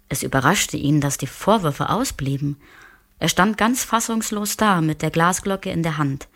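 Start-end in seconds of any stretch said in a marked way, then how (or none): none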